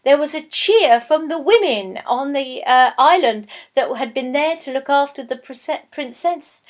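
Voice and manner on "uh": plain reading voice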